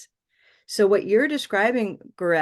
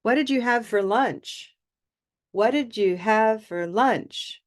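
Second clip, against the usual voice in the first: high pitch through the question, falling on LUNCH; focus stress by tone; 4 semitones higher than usual. Shown as a demonstration. Teacher demonstrating extra stress to students, change of tone on LUNCH. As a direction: mark 'lunch' with a clear change of tone to a fall. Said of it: "by using a change of tone".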